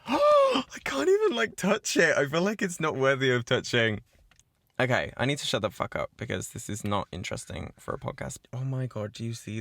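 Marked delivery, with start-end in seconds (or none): 0.1-4.0 squealing awe. Real, not acted. Afraid to touch her new phone, a squealing gasp.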